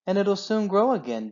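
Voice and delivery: high eager little fox; chirpy young cub; small child voice. Acted.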